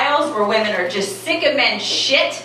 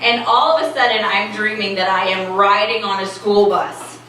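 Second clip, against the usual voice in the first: ordinary talk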